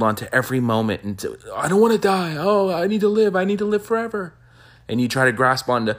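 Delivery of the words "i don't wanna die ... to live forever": high, whiny cry of someone clinging to life; hammed-up panic, much higher than usual; mock desperate pleading. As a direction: plead in an exaggerated high wail. Mimicking people clinging to life.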